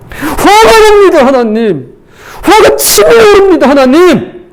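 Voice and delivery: high-pitched, drawn-out angry cry; angry outburst to God. Acted. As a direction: cry out in raw complaint to God. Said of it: "이렇게 말이죠".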